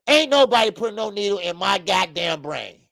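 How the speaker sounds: normal talking voice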